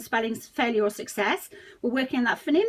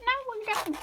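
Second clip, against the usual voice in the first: a lightly voiced puppet answering in a high squeak; mock puppet reply, pitched up; Speech Sound King puppet; 10 semitones higher, 4 dB quieter than usual. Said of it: "do we, Speech Sound King?".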